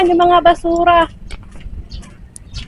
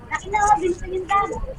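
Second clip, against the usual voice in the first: soft laugh; 10 dB quieter than usual. Real, not acted. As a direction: laugh quietly to yourself. Laughs after explaining she is vlogging.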